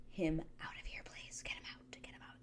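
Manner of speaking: barely audible mock-desperate whisper; hushed, repeated pleading whisper; whispered plea. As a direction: beg under your breath. Begging for contestant's removal.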